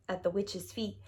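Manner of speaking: ordinary delivery, nothing marked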